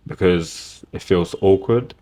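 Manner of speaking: usual speaking voice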